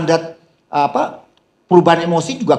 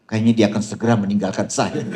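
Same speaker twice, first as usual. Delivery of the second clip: laughing joke; 6 semitones lower than usual. Retold or imitated. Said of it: "Saya bilang".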